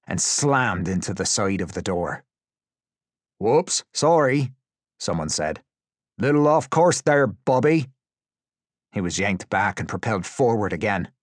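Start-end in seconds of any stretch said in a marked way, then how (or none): 3.4-4.5 higher, drawn-out whoops; oops-sorry from a careless captor; mock apology. A narrator's reading. Captor shoving hooded prisoner, mock apology. 6.2-7.9 higher, louder jeer; mock-friendly jibe, pitched up; taunting captor. A narrator's reading. Captor teasing hooded prisoner.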